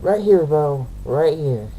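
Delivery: loud, mock-insistent retort. Real, not acted. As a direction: shout it back playfully. Jokingly insisting on correction.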